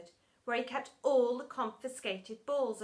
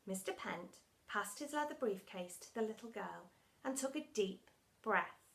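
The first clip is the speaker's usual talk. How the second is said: ordinary delivery, nothing marked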